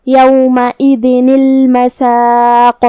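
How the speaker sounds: یومئذ المساق with tanween read as نِ linking into لام, slow; joined recitation. Shown as a demonstration. Teacher demonstrates the linking, slow recitation. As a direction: join یومئذ to المساق through a short نِ. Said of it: "تنوین کو جو کہ نون ہے اسے زیر سے پڑھیں گے".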